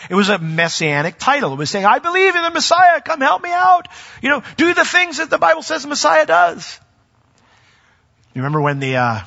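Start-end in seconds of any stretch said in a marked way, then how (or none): 1.9-6.8 much higher and louder, a begging cry; pleading call. Retold or imitated. Petitioner begging Jesus for help, high loud plea. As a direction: call out over the crowd for help.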